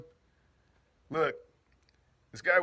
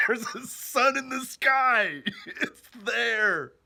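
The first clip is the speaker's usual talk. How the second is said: higher, slow and amused, laughing through the words; 8 semitones higher, 6 dB louder, much slower than usual. Real, not acted. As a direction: chuckle it out like it's obvious. Joking about solar, laughing.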